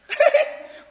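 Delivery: loud, high real laugh; high burst of laughter. Real, not acted. Preacher laughing at his own story, high laugh. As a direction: break into a high laugh at the joke.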